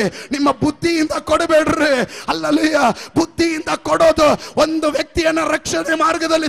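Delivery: fervent pulpit appeal; preaching at full force. Acted. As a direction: preach it out to the whole congregation. Preacher addressing fellow preachers.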